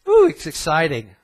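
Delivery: loud, mock-thrilled ooh; sarcastic mock excitement. Real, not acted. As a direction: feign delight to mock them. Mocking scientists' new theory.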